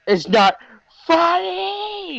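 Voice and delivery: very high, drawn-out squeal; hammed-up wounded protest; mock upset protest. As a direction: imitate a tantrum, pitch leaping on the last word. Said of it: "And Mike's just like".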